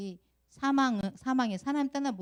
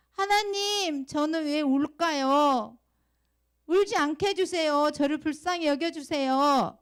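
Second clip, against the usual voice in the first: loud, high, pleading prayer shout; crying out in prayer; 7 semitones higher, 7 dB louder, slower than usual. Shown as a demonstration. Teacher models for Sua praying like Bartimaeus, shouted plea. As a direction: cry out for mercy at full voice. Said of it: "소리를 지르면서 나를 불쌍히 여겨달라고 기도하잖아요".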